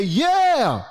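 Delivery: high, drawn-out mock amen-corner shout; congregant calling out. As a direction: call back like one approving listener. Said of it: "get one person to say".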